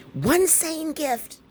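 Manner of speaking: slow, high-pitched squeal of emphasis; high exclamation. Real, not acted. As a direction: fling each word up high, stretched out. Exclaimed repeat of the announcement.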